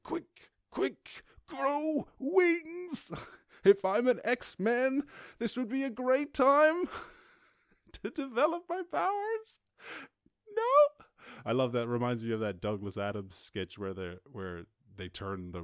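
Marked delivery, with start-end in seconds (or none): 0.0-9.6 high squeaky mock panic; mock panicked self. Joking about growing wings while falling, high squeaky voice. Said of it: "I'm like". 10.5-11.0 high, loud mock cry; mock wail. Ending the free-fall joke, a high mock wail.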